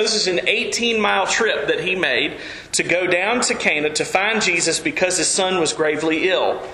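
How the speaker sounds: usual speaking voice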